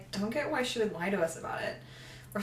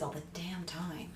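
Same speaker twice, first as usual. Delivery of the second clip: quick snarky whisper; whispered wisecrack to a friend; whispered aside; 2 semitones lower, 9 dB quieter than usual. Real, not acted. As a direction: mutter it under your breath as a joke.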